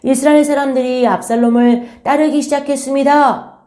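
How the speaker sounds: high, loud acted herald; messenger's urgent report. Messenger bringing bad news to King David, urgent acted report. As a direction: play a messenger crying out news. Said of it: "그 소식은 다윗 왕에까지 전해졌어요".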